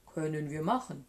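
low storybook monster voice; low-voiced beast going along with it; Gruffalo monster. A narrator's reading. Monster answers after laughing.

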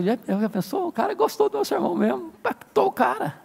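squealing with delight over his own sermon; excited pastor. Retold or imitated. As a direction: gush like the proud preacher. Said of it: "ficou todo empolgado".

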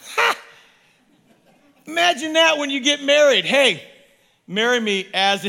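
bubbling over with laughing joy; excited laughing. Real, not acted.

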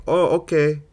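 a touch of an Indian caller giving up; mild Indian accent. Retold or imitated. Caller hanging up, light Indian accent. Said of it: "I don't have the best Indian accent".

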